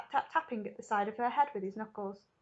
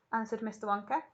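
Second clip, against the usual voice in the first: plain reading voice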